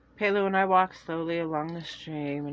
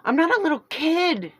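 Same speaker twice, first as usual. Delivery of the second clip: shouting back; 10 semitones higher, 8 dB louder than usual. Acted. Girl shouting back at sister.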